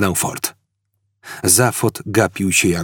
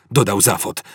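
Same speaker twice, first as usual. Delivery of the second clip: plain reading voice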